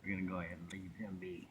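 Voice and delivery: hushed and soft so as not to scare it; wildlife watching whisper. Real, not acted. Quietly watching a baby animal.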